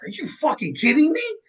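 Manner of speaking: playing his wife, stunned and thrilled; high-pitched mock shriek of disbelief; wife's shocked disbelief. Wife voiced reacting to news.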